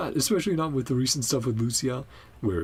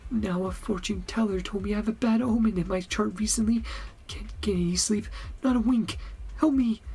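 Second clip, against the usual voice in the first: higher-pitched pleading game character; worried sleepless client; 11 semitones higher than usual. Acted. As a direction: play a sleepless client begging for help.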